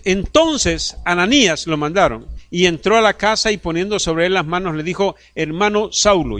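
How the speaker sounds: loud, high pulpit preaching; lively sermon voice; fervent preaching. Acted. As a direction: drive the story home to the church. Preaching at full force.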